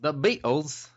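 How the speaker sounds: rough stab at British; attempted British accent. Shown as a demonstration. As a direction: try British and miss slightly. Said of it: "my British accent's terrible".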